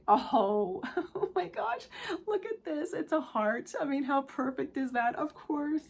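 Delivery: high, laughing gasp of delight; delighted surprise. Real, not acted. Tarot reader thrilled to draw a heart charm, delighted surprise. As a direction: marvel at it, pitch leaping up through laughter.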